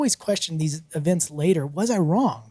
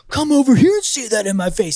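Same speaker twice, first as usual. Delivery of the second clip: loud, higher mock-angry challenge; hot-headed dare, raised voice; imitated blow-up; 6 semitones higher, 6 dB louder than usual. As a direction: imitate a neighbor blowing up. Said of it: "totally blows up on that".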